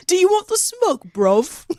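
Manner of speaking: mock British challenge, starting high; modern British slang accent. Teasing her co-host, mock modern British accent. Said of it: "that's the modern equivalent of".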